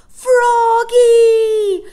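high, full shout stretched out; long drawn-out yell. A narrator's reading. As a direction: bellow the name over wind and sea. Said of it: "Michael's voice was stronger".

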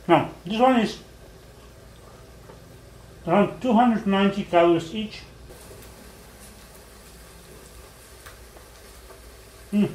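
speaking between bites; talking while chewing. Real, not acted. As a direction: mouth partly full. Seen 0: Puts burger piece in mouth at 0.7, then talks while chewing.

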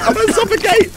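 high-pitched fake alarm; hammed-up falsetto distress; mock panic. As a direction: squeal it in pretend panic.

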